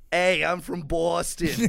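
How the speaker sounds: put-on slow, loud Boston accent; hammed-up Boston accent. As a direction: lay on a thick Boston accent. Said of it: "my impression of Boston".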